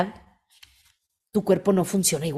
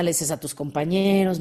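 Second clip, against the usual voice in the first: ordinary delivery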